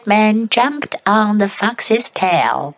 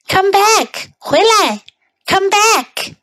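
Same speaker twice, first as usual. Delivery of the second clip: calling out; 10 semitones higher than usual. Shown as a demonstration. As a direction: call after the runaway. Chaser calling out.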